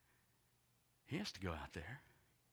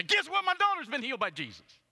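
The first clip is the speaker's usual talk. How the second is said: high, very loud jubilant announcement; excited shouting; 15 dB louder than usual. Acted. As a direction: shout the news to everyone like an overjoyed dad. Imagined dad announcing the miracle.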